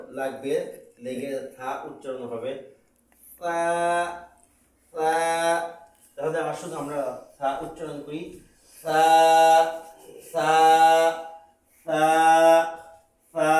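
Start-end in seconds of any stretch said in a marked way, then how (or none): 3.4-13.5 সা said softly, tongue tip touching the teeth. Shown as a demonstration. Teacher demonstrating a soft সা for Arabic learners. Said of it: "এই সাটা নরম হবে".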